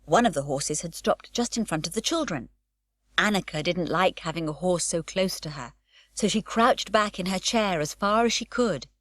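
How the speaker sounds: usual speaking voice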